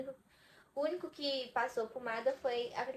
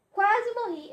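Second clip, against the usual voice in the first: higher and louder on Quase morri; dramatic repeat, much higher and louder; emphatic exclaim; 9 semitones higher, 10 dB louder, slower than usual. Real, not acted. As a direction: hit Quase morri as a pained outcry.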